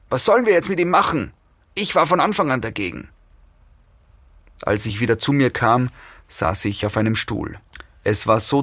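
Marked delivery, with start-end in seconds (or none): 0.1-3.1 fast, higher-pitched squabble; quick, heated protest; agitated arguing. A narrator's reading. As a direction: argue hurriedly in a raised pitch. Kidnappers argue over captive.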